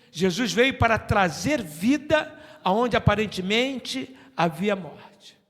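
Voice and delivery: loud, slow, high declaration; fiery preaching. Acted. Pastor closing the sermon in a fiery declaration. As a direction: thunder the promise to the church.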